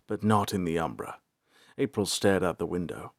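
ordinary delivery

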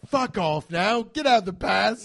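loud, high chef's rage; acted furious dismissal; yelled order. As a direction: drive them out of the kitchen. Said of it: "And then he yells".

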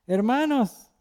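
high calling out to the group. Retold or imitated. Neighbor calling after the departing brothers, high-pitched call. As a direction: call after them from the doorway.

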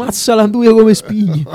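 high, slow Roman catchphrase; Roman accent. Retold or imitated. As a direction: savour it like a Roman food critic. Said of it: "è una sua grande cheat".

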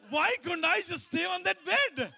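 loud, high cry of regret; exasperated outcry. Acted. Man regretting he left the bed, crying out to God in exasperation. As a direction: wail it at God, drawn out and hammed-up.

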